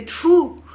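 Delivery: "Tfu" spat out high and loud; spitting tfu. Shown as a demonstration. Teacher shows the spitting "Tfu" of disgust. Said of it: "Or sometimes you just say".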